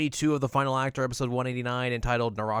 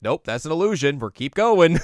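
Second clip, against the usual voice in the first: louder, raised "nope, keep going"; curt dismissal; 6 semitones higher, 9 dB louder than usual. Retold or imitated. Sesshomaru dismissing the fake Rin, loud and curt. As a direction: wave it off loud and impatient. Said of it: "He's like".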